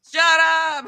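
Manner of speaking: "Shut up" thrown out high and loud in giddy disbelief; delighted disbelief. Retold or imitated. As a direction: squeal "Shut up" like the praise is too much. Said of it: "And I'm like".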